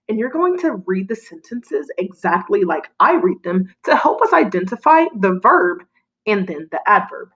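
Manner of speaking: normal talking voice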